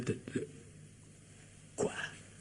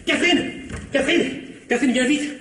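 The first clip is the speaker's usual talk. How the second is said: calling out; 10 semitones higher than usual. Acted. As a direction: shout for her across the house, urgent. Husband calling wife from another room.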